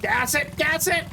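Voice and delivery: high, rising urgency without shouting; sudden excited push mid-game; urgent urging. Real, not acted. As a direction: urge it on, pitch climbing. Urging himself on while playing.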